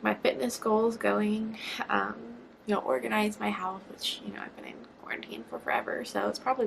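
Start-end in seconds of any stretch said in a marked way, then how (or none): 3.9-6.0 fast, softer whisper; whispered aside. Real, not acted. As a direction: toss it off in a whisper.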